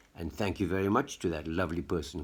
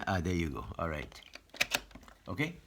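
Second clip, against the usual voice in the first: normal talking voice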